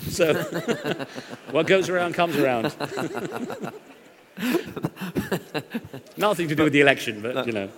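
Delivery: real laughter through the punchline; laughing. Real, not acted. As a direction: chuckle while delivering the moral. Laughing at his own punchline.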